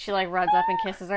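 usual speaking voice

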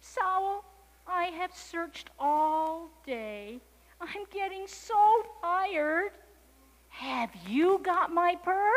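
high, very slow weary whine; sleepy, tired kitten. A narrator's reading. Sleepy kitten tells the owl, high, slow and worn out. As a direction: drag out the words in a high kitten voice. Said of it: "Kitten was getting sleepy".

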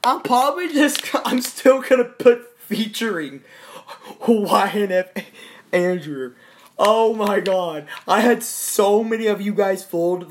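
high, loud, laughing excitedly; can't stop laughing. Real, not acted. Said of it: "laughing excitedly".

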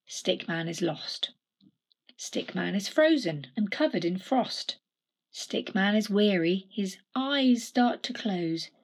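ordinary delivery, nothing marked

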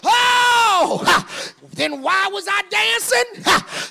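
loud whooping preacher cadence, gasped ha after each phrase; preaching at full force. Acted. Preacher whooping to the church at the sermon's climax, loud and rhythmic.

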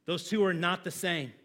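ordinary delivery

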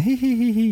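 high little mock giggle, hee hee; imitating her giggle. Teller mimics the woman on the phone giggling, high mock giggle. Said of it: "Og så ler hun litt sånn".

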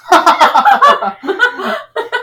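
high, loud burst of laughing; loud laughter. Real, not acted. Laughing hard at the joke.

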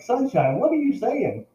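high, mock-baffled question to a pet; singsong incredulous scolding, acted out; retold bewilderment. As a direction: ask the bird in playful disbelief. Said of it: "I'm like".

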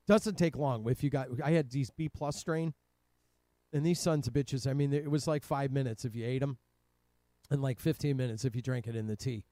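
ordinary talk, nothing marked

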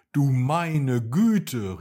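loud, drawn-out exclamation; calling out. A narrator's reading. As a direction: cry out slowly as the storybook dragon. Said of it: "rief Fritzi". (lauter, gedehnter Ausruf)

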